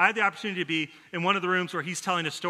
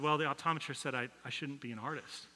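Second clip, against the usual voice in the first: put-on quiet, deflated child's voice; boy softly telling his mom the bad news; hushed heartbroken boy; 3 semitones lower, 10 dB quieter than usual. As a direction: let it fall out small and crushed. Boy tells mom through tears.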